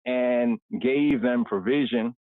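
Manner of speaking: normal talking voice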